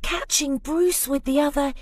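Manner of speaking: ordinary delivery, nothing marked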